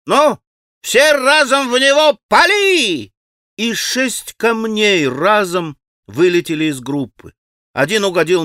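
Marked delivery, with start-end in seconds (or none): 0.1-3.1 put-on high, loud, drawn-out boyish order; rally the gang with a long yell; shouted battle command. A narrator's reading. Boys launching a volley of stones, shouted command.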